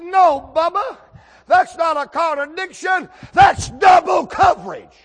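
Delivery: high, building shout; preacher hollering back his comeback; shouted rebuttal. Retold or imitated. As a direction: roar the punchline at Bubba. Acted punchline rebuttal to Bubba.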